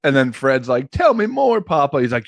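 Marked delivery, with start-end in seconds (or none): 0.9-2.0 squeaky high plea to papa; mock sweet son. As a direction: play the needy son, pitched way up. Goofy acted son quote in a bit.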